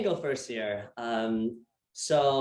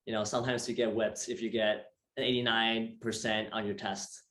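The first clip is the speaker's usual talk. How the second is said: normal talking voice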